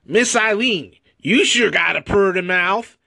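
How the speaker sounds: leering country bumpkin voice. Acted. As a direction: lay on a thick hillbilly accent. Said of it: "he says it in a country voice".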